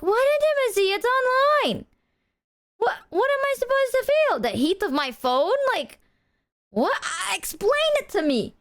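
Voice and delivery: high, mock-baffled rant; exasperated. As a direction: play it all baffled and pushy.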